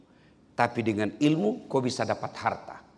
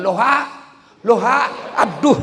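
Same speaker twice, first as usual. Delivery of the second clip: loud, high shouted loha; shouting loha; 8 semitones higher, 10 dB louder than usual. Retold or imitated. Rich man shouting halo backwards into the phone, loud. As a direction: yell loha into the upturned phone. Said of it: "Dia bilang".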